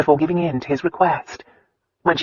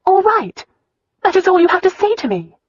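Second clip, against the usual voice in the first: much higher, incredulous echo; indignant disbelief; 5 dB louder than usual. A narrator's reading. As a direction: throw his words back at him, stunned. Wife echoes in disbelief.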